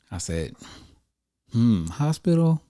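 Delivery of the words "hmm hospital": skeptical. Retold or imitated. Weighing hospital against money.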